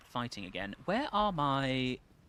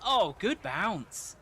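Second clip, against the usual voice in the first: excited; 8 semitones higher, 7 dB louder, much slower than usual. Real, not acted. Watching a good bounce.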